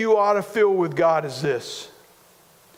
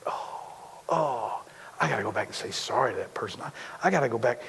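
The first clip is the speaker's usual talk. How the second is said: quick and soft, low, like muttering to yourself; a hurried conscience talking under its breath; urgent inner voice; 7 semitones lower, 7 dB quieter, faster than usual. Retold or imitated. Acting out inner conviction.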